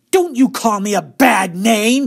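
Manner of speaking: loud, high, slow complaint; indignant shout. A narrator's reading. Girl hoping her mother hears, indignant shout. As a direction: shout so a parent will overhear. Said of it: "she shouted".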